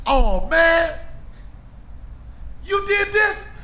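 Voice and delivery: high, loud amazed gasp; awed kids. Retold or imitated. Kids marveling at his drawing, high amazed cry. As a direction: play the kids gushing in awe. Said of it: "They used to do like this".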